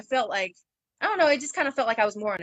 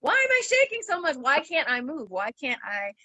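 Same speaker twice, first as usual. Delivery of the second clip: high-pitched bewildered questions, retold; bewildered outcry easing down in pitch; confused alarm; 8 semitones higher than usual. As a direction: act out panicked confusion, starting very high. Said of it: "I'm confused just as much".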